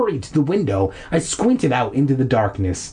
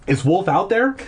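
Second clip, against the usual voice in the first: a touch of worry, pitched up; anxious question; 7 semitones higher than usual. Acted. Boy asking if his dog is outside, mildly anxious. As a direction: ask it anxiously, voice lifted. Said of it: "I asked anxiously".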